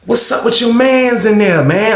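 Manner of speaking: high-pitched roommate bringing news; imitated urgent gossip. Roommate asks storyteller about his cellmate, imitated urgent gossip.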